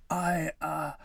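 caught-out, halting attempt to answer; sputtering. A narrator's reading. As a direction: fumble for an excuse when cornered. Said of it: "Pim sputtered".

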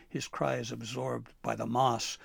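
plain reading voice

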